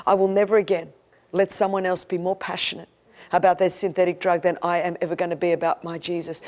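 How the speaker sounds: ordinary talk, nothing marked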